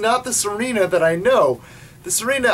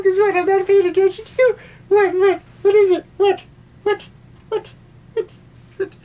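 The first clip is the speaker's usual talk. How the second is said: high, exasperated crying whine; whiny at the cat; 13 semitones higher than usual. Real, not acted. Scolding the cat mid-review.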